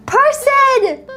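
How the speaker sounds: high-pitched excited shout of a name; excited call-out. Real, not acted. Streamer hailing a superchatting viewer, high excited call-out.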